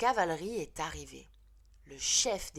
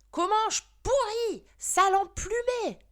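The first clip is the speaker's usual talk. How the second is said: put-on much higher and louder, a shrieking girl; screamed insult; 9 dB louder than usual. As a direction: shriek it at him while shaking him. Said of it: "a hurlé Mathilde".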